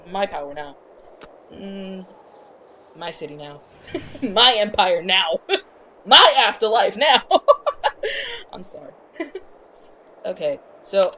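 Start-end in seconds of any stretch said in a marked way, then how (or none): 0.1-7.2 gloating villain. Retold or imitated. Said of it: "he was like". 7.3-8.5 bursting high laughter; real laugh at his own joke. Real, not acted.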